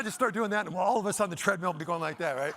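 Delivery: usual speaking voice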